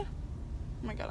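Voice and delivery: breathy hushed aside; embarrassed whisper to the camera. Real, not acted. Hiding from boys nearby.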